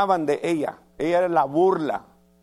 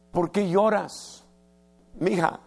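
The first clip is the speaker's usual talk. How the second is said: gentle, concerned questions, a little higher; worried, tender husband; 4 semitones higher than usual. Retold or imitated. Husband Elcana gently asking his crying wife, tender and worried. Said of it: "Vino con una gran preocupación".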